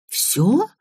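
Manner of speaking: astonished question, pitched high and rising; can't believe that's all; incredulous, sharply rising question. A narrator's reading. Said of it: "поразилась Елизавета".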